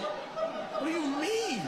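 high, drawn-out disbelief; slow, high-pitched exasperated question; incredulous. Acted. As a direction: stretch it out in mock bafflement. Mock disbelief at dad's sunburn.

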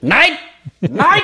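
shrill high shouted no; ranting dictator, hammed up; Hitler impression. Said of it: "Wie Hitler eigentlich". (Hitler-Parodie, schreiend)

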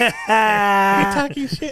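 slow, drawn-out shouted challenge; mock tough-guy shout. Friend imitating angry Danny confronting Alyssa, slow mock shout. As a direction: lean in and bark it slowly. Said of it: "He goes".

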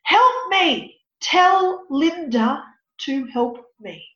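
loud high cry, then slow and drawn out; desperate shout, then slow pleading; yelling for help. Retold or imitated. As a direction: call out like the frightened boy. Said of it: "The little boy yells out".

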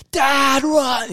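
put-on high, loud frightened child's cry; panicked kid yelling. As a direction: scream it like a terrified teen daughter. Imagined daughter screaming at dad.

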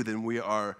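ordinary talk, nothing marked

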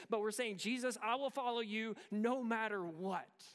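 loud, high, driving sermon voice; impassioned preaching. Real, not acted. Preacher urging church to wholehearted faith, impassioned.

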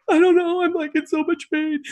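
high, small pitiful voice; pained whimper. Retold or imitated. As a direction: plead helplessly in a tiny high voice. Retold plea to the doctor in pain.

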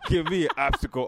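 high, loud laughing outburst; laughing protest. Real, not acted. Mocking a friend who fumbled his own challenge, laughing. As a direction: call out the challenger through laughter.

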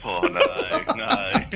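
groaning laugh, voice jumping high. Real, not acted. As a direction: laugh and groan at the bad joke. Groaning laugh at bad joke.

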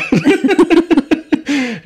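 a sharp, high burst of laughter; high loud laugh. Real, not acted. Laughing loudly after retelling the threat to quit. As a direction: crack up at the memory.

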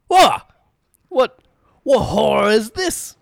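loud, panicked high cry; startled shout. Acted. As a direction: jolt awake screaming in horror. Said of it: "I'm like".

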